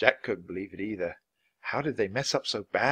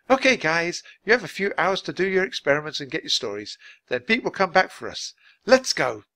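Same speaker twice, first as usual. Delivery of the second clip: lightly voiced leader, higher and louder; brisk group leader; 7 semitones higher, 6 dB louder than usual. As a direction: round up the team to set off. Group leader rallies the team.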